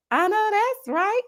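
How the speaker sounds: high, slow, drawn-out cheer; high emphatic hype; hearty agreement. Real, not acted. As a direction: sing out your approval, stretched high. Cheering a two-year member.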